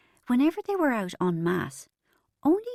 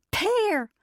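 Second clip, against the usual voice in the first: much higher, burst out; snorted through laughter; 13 semitones higher than usual. Acted. Bridget finally blurting the punchline through laughter. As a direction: snort the word out at last. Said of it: "she finally snorted".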